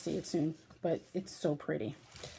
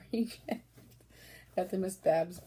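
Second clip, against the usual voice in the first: ordinary talk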